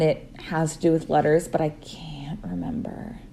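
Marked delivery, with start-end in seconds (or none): 1.5-3.2 breathy whisper to herself; whispered aside. Real, not acted. Guest trails off into a whisper while recalling a book. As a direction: drop to a whisper, half to yourself.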